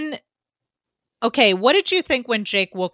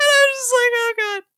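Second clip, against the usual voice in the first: high, louder, laughing almost into crying; laughing near tears; 5 dB louder than usual. Real, not acted. Recapping a favourite joke to a co-host, laughing near tears. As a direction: laugh helplessly as the line breaks you. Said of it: "I'm gonna start crying".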